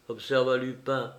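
usual speaking voice

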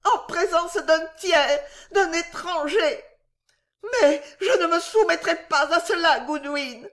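very high hysterical outrage; sobbing. A narrator's reading. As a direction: sob out each grievance, voice way up. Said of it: "sanglota madame Pott". (sanglots indignés et suraigus)

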